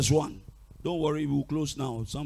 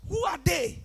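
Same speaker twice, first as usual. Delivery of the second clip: scoffing; 14 semitones higher than usual. Real, not acted. Preacher belittling witches to congregation, high-pitched scoffing. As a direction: toss it off in a high voice.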